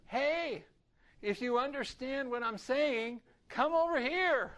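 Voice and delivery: loud, high, slow call across a hill. Retold or imitated. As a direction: shout far to gather your own people. Calling out to German speakers.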